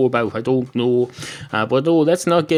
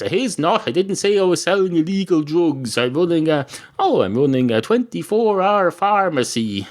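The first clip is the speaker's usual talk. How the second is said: higher-pitched, mildly hammed-up denial; defensive protest; 7 semitones higher than usual. Barman denies selling illegal drugs to customer, defensive. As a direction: protest your innocence, pitched up.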